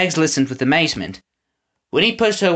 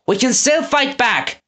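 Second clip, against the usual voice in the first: rallying cry; 11 semitones higher than usual. A narrator's reading. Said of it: "Eggs cried".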